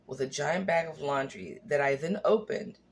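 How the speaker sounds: ordinary delivery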